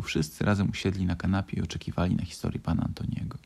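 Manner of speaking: ordinary delivery, nothing marked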